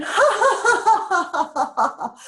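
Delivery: high burst of laughter into a giggly so funny; ha ha ha, then giggling words; laughing it off. Shown as a demonstration. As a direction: laugh at the driver who cut you off. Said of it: "just laughing and saying".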